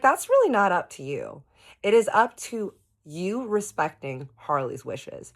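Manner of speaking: high, loud, slowing emphatic correction; retold firm rebuke. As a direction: set him straight in acted indignation. Retold quarrel, correcting Bob.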